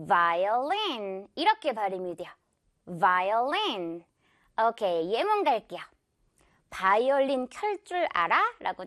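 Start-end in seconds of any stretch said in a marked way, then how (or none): 0.1-4.0 "violin" lower, drawn out, buzzing v; clear English V. Shown as a demonstration. Teacher modeling violin for learners, clear V. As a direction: start "violin" with teeth on lip. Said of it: "요거 V 발음 살려서".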